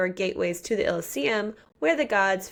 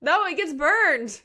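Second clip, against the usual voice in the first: high, slow exclaim; 9 semitones higher, 4 dB louder, slower than usual. Real, not acted. Blunt answer about the finger.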